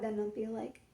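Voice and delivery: ordinary talk, nothing marked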